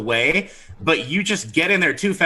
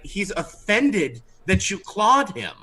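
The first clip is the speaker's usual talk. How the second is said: ordinary delivery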